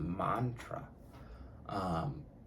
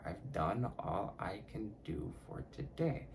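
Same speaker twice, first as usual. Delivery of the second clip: mantra said slowly, word by word; slow, deliberate mantra, carefully articulated; 5 dB quieter than usual. Shown as a demonstration. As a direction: take the tongue twister slowly and softly. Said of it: "I have to say that shit slowly".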